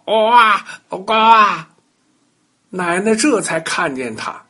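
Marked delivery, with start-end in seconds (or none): 0.1-1.7 high, loud forceful croaking. Acted. Toad croaking loudly at grandma's feet. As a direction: croak as hard as you can. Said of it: "使劲的大声叫".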